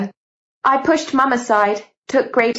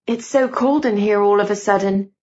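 ordinary delivery

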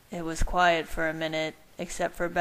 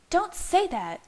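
shriek; 13 semitones higher than usual. A narrator's reading. Leah cuts off Bella's claim of love, shrieking. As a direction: shriek it in protest. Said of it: "Leah shrieked".

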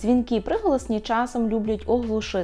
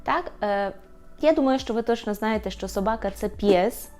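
ordinary delivery

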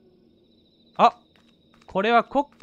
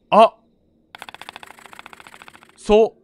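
ordinary talk, nothing marked

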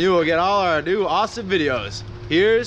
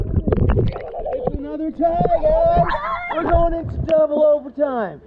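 excited announcement; 7 semitones higher, slower than usual. Real, not acted. Host announces another tie.

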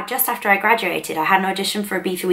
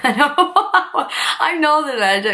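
laughing; 8 semitones higher, much slower than usual. Real, not acted. Laughs at her own accent claim.

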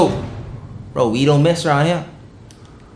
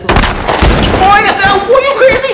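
high, stunned disbelief; real startled surprise, pitched up high; shocked outburst; slower than usual. Real, not acted. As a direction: gasp it out, you nearly shot her. Shocked he nearly shot Ellie.

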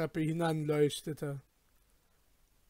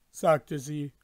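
plain reading voice

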